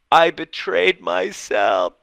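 high, slow, crying voice; imitated bawling. Client realizes her own betrayal, mock sobbing. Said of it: "She starts bawling".

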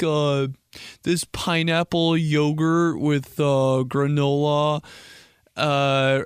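um and uh through a fussy food answer; mock picky foodie. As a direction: imitate someone slowly listing fancy favorites. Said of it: "When people are like".

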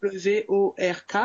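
slow letter-by-letter spelling. Shown as a demonstration. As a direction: spell each letter out clearly. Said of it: "Est-ce que tu peux épeler".

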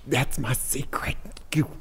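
hushed secretive whisper. Acted.

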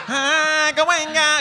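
voix suraiguë et forte, pour rire; tentative de parler. Retold or imitated. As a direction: imiter son propre essai de parler, très haut perché. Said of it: "J'essayais de parler". (imitation suraiguë de quelqu'un qui essaie de parler)

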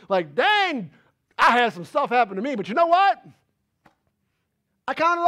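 loud, high preacher cadence; preacher exhorting the church loudly; full-force preaching. Acted. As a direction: preach it out to the congregation. Voicing Paul, preaching.

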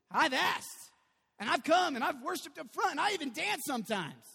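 loud, high, indignant listing of efforts; frustrated believer. Acted. Believer complaining that prayers went unanswered, indignant.

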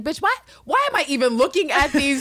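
high, loud mock-incredulous outburst; exasperated retort. Host voicing Angela baffled by the pictures, exasperated.

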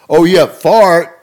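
high, loud, slow silly delivery; milk the dumb joke; goofy kid punchline. Retold or imitated. Frank repeats punchline.

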